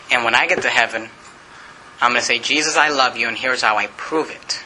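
low, reverent prayer voice; closing prayer. Real, not acted. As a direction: lead the congregation gently to amen.

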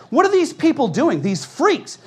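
high, building pulpit denunciation; impassioned preaching. Real, not acted. Preacher denouncing protesters to his congregation, impassioned preaching. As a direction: build to a loud, outraged pulpit cry. Preacher works up to full force.

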